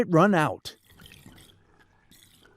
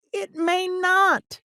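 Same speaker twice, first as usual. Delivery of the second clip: put-on worried child; 12 semitones higher, slower than usual. Boy answers.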